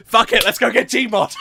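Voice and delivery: rally everyone, fired up; fired-up shout; hyped shout. Real, not acted. Hyped streamer.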